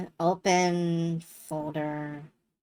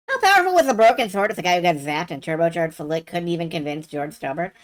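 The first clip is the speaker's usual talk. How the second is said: ordinary talk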